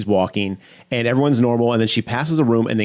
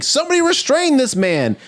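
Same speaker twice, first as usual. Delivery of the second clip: panicked plea. Retold or imitated. Nurse panicking as patient rushes.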